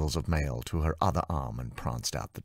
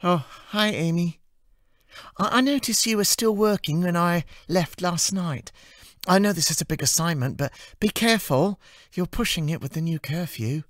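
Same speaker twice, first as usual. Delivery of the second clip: put-on much higher, chirpy young woman's voice; bubbly and earnest with a warning; cheerful assistant; 5 dB louder than usual. Amy warning Anna about the curfew, in a high cheerful voice.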